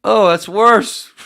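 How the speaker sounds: loud, high, drawn-out cry; amused dismay. Real, not acted. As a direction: wail it out, about to burst out laughing. Sees the hair looks worse.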